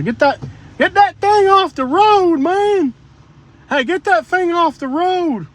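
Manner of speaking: real yell at a bulldozer blocking the road. Real, not acted. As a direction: shout at the bulldozer to get out of the way.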